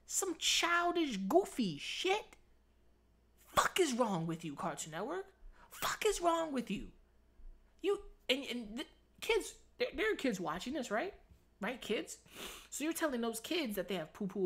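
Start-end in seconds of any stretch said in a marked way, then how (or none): 0.1-2.4 high, slow mock-childish voice. Mocking the voice actor's line. 5.7-10.2 "Fuck is wrong with you?" pitched high in outrage; outraged. Real, not acted. Ranting at Cartoon Network.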